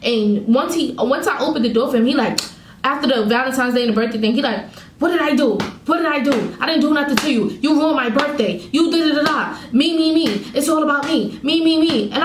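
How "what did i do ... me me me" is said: higher-pitched mock complaining, imitating boyfriend; mocking imitation. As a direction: mimic his self-centred protests in a raised voice. Mimicking boyfriend's self-centred complaints.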